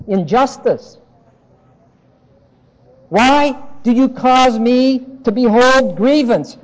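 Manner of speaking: loud, high, driving sermon voice; fervent preaching. Acted. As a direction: preach the outrage hard to the congregation. Preaching at full force.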